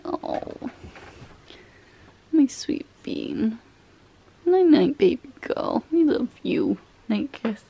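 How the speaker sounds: soft, slow goodnight to pet; sleepy talk to pet. Real, not acted. Saying good night to pet.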